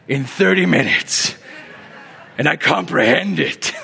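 booming mock-triumphant boast; Ken Graves. Preacher mocking the idea of reaching peak Christianity, grandiose put-on voice. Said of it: "I'm doing a Ken Graves voice".

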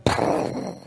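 wordless whoosh of an explosion; imitated explosion. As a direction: blow out a puffy blast noise. Imitating blast after "just from.

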